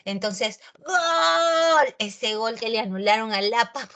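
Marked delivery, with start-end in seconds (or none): 0.8-1.9 high, loud reliving of the goal; goal shout. Retold or imitated. Teacher reliving the disallowed goal for her class, shouting. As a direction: yell it again like the match is on. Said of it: "que lo grité".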